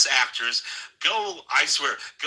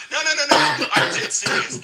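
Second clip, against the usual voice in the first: loud, high burst of no no no; emphatic protest; 13 semitones higher, 4 dB louder than usual. Real, not acted. Cohost protesting to host, loud and insistent. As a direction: cut him off, insisting hard.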